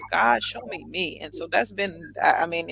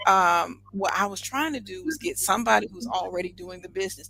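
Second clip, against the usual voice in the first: ordinary talk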